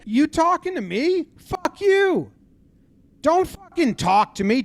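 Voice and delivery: high-pitched, indignant mock outburst; angry retort. Orioles manager to the Yankees dugout, angry and high-pitched. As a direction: imitate a furious manager challenging the dugout.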